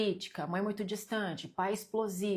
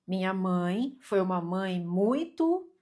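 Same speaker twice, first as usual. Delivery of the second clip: "muito" drawn out slowly and left hanging; fill-in-the-blank prompt; 4 dB louder, slower than usual. Shown as a demonstration. Teacher asks viewers to finish the sentence, prompting with a long "muito". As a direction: stretch "muito" and stop, inviting completion. Leaves blank for audience to complete.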